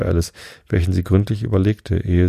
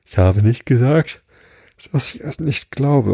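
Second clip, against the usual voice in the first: higher-pitched, mildly indignant objection; protesting interruption; 6 semitones higher than usual. A narrator's reading. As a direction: cut in and protest, pitch jumping up. (protestierend dazwischengerufen, mit erhöhter Stimme)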